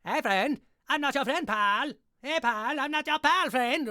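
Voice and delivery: hammed-up, high, loud cartoon Canadian argument; Terrence and Phillip bit. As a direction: bicker like the South Park duo. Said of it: "very similar to".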